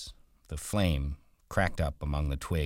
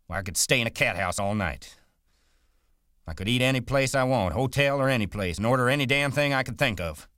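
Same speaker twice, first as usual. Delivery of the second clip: angry rant; 5 semitones higher than usual. Acted.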